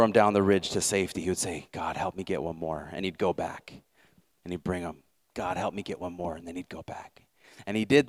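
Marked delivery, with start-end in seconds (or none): 1.7-2.9 softer, lower whispered plea to God; quiet prayer. Retold or imitated. Desmond praying as he lowers the wounded, softer. 5.3-6.3 softer, lower whispered plea to God; quiet prayer. Retold or imitated. Desmond praying as he lowers the wounded, softer.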